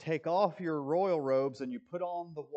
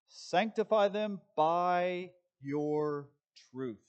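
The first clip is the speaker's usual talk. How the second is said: drawn out, weighty SANCTIFY; slow scripture read; 3 semitones higher, 5 dB louder, much slower than usual. Acted. Preacher reading the verse to the congregation, slow and weighty. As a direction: lean hard and slow into Sanctify.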